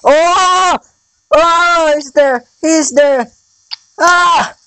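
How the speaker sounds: high frantic cries of fright; startled high-pitched panic; real panic. Real, not acted. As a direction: yelp as the animatronic appears. Jumpscare from Foxy in game.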